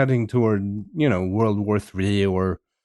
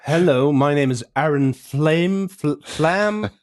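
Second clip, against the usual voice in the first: put-on American accent; 4 semitones higher, slower than usual. Host showing his own American accent, hammed-up. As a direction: show how an American would really say it.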